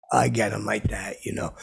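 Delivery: ordinary delivery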